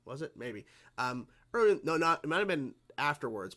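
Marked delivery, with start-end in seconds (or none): none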